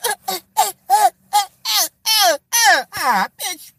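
high fake sob sounds; hammed-up crying uh-uh-uh; mock crying stammer. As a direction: wail it like she's choking up. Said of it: "And Yandy's like".

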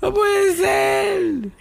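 a startled high shriek that breaks into a yell; panicked squeal. Retold or imitated. Man panicking in bed at his cracking hips, high shriek. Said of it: "hay huesos que yo no sabía".

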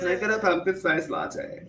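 mock pumpkin spice girl.

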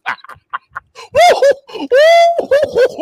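high, loud crowing laugh; loud gloating shriek of laughter. Real, not acted. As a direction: howl with laughter at his loss.

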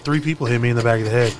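normal talking voice

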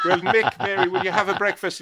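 high, loud Irish landlady voice; landlady's voice. Retold or imitated. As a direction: bark it out like a brusque old landlady.

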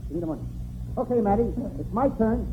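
normal talking voice

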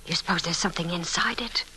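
hushed, conspiratorial whisper; secretive whisper to the maid about the box. Acted. Secretly searching his bags.

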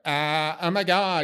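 high-pitched mock LA accent. LA doctor reacting to a patient's heart, mock LA accent. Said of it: "Or some L A accent, like".

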